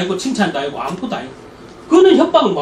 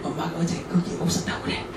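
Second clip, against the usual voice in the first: put-on hushed whisper into the child's ear; secret whisper; 5 semitones lower, 14 dB quieter, slower than usual. Mother whispering to her child to lie on the phone, hushed whisper. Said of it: "권사님이 귓속으로 걔한테 시켜".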